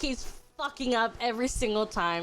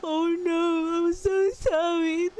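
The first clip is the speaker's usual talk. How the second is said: high, slow, whimpering sorry; whiny apologetic sidekick; 7 semitones higher, 4 dB louder, slower than usual. Retold or imitated. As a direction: whine the apology like a crybaby. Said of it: "And he's like".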